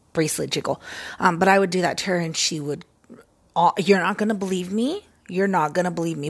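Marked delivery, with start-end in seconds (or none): none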